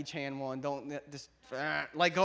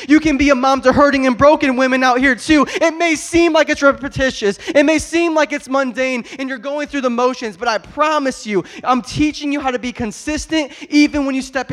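loud and higher, preaching hard to the congregation; preaching at full force; 9 semitones higher, 18 dB louder than usual. Acted. Pastor preaching to the church at full force. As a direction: lift the room, preaching full out.

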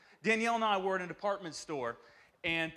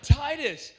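gentle question; 3 semitones higher, 3 dB louder than usual. Retold or imitated. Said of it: "And I said".

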